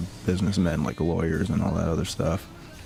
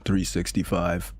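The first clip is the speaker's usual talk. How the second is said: usual speaking voice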